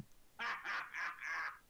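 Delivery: high, jeering orc cackle; loud orc laugh. Acted. Orc laughing at a failed gate charge, high cackle. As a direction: laugh mockingly in separate bursts. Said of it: "hear an orc laugh very loudly".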